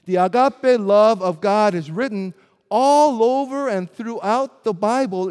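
ordinary delivery

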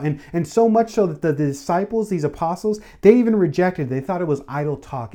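fervent preaching, pitch lifted, pressing the point; preaching at full force. Acted. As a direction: build the sermon to a joyful peak. Preacher builds the story.

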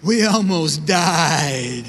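slow, dazed stoner drawl; stoned friend. Retold or imitated. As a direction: friend in back seat, slow dazed dude voice. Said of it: "said something like this".

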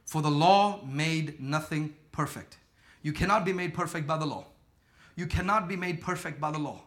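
low, soft, measured reading of the verse; quiet scripture reading. Real, not acted.

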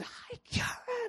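voiceless, strained squeak of a greeting; lost voice. Retold or imitated. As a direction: imitate a voice gone from yelling, slow and barely there. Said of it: "I discovered I lost my voice".

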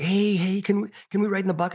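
high, fast, eager pleading; eager kids begging. Retold or imitated. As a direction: beg like an excited little kid. Kids begging dad for ride.

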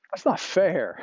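high-pitched protest; crying out. Acted. Listeners objecting on behalf of Moses, crying out in protest. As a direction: cry foul, aggrieved. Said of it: "you're sort of crying out".

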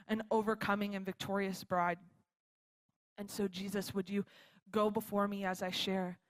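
quiet, even, reverent praying voice; prayer. Real, not acted. Speaker leads congregation to God before sharing, subdued prayer. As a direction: pray gently, in measured phrases with pauses.

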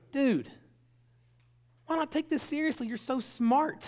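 very high-pitched retold appeal; exasperated pleading. Principal retelling his appeal to a smart student, exasperated pleading.

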